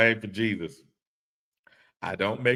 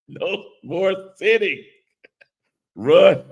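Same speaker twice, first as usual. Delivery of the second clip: mock yell; 11 semitones higher, 8 dB louder than usual. Reading Papa's comment, mock shouting.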